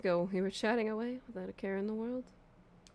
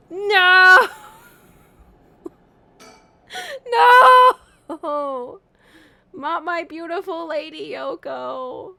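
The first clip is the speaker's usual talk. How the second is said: higher, louder over-the-top mock sobbing; melodramatic wail; 9 semitones higher, 15 dB louder, much slower than usual. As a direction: ham up the grief for the dead girl. Mock mourning over Yoko.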